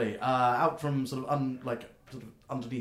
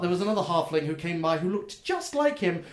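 much higher, eager question; chirpy gnome; 6 semitones higher, 6 dB louder than usual. Acted. Gnome checking the order with the customer, squeaky high. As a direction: ask it like a delighted shopkeeper.

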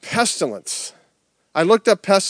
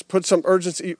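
ordinary talk

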